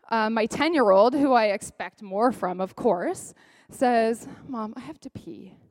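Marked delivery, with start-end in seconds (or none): 4.5-5.6 hushed imitation of a child; child's plea. Ten-year-old telling mom in the car, hushed plea. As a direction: say it small and soft, like a kid.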